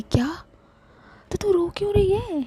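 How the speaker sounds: high, startled why are you crying; surprised friend. Acted. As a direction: jump up in surprise. Shivi surprised. (चौंकी हुई, ऊँची आवाज़ में सवाल)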